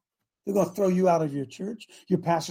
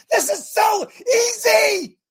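high, slow, full-throated cry; fervent shout; 14 semitones higher, 6 dB louder, much slower than usual. Real, not acted. Preacher crying out to his audience, loud and slow. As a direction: shout it out to rouse the room.

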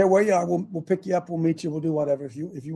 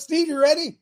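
raised, high-pitched hail across the room; calling out loudly; 10 semitones higher, 3 dB louder than usual. Real, not acted. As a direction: yell over to someone by name. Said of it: "I'm yelling over there".

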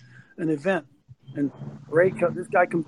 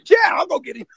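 much higher, loud, eager yes; eager shout; 11 semitones higher, 4 dB louder than usual. Retold or imitated. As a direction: shout it like you can't wait. Acting eager Ray's reply.

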